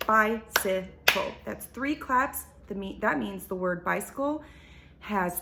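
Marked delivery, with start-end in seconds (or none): 0.1-1.3 slow, every syllable separated, BI-CY-CLE; clapped out by syllable. Shown as a demonstration. Teacher clapping syllables for second graders, slow and separated. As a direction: split "Bicycle" into three clear beats, one per clap. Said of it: "I can clap out the sound bicycle".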